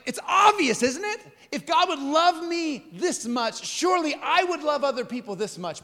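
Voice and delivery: high-pitched, emphatic conviction; preacher's pleading insistence; insistent certainty. Real, not acted. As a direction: press it home as self-evident. Argues it is obvious.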